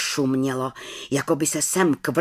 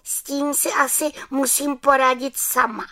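high-pitched little witch voice; high, resolute little witch; 10 semitones higher than usual. Acted. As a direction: speak up high as a small witch. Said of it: "řekla malá čarodějnice".

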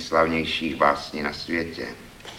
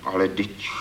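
whimpering. A narrator's reading. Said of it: "zaupěl hlas".

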